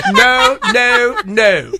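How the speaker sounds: hammed-up British accent, jumping high on the refusal; bad British accent parody of a singer. Shown as a demonstration. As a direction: put on a playful, exaggerated British voice. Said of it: "Can you just do your British accent one more time?".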